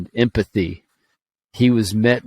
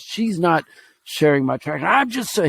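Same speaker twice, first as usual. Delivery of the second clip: put-on high, pouting child's voice; imitating an upset kid; 7 semitones higher than usual. Nephew complaining about his tractor, high pouting voice. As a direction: whine it like a hurt six-year-old. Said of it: "he keeps going back to this".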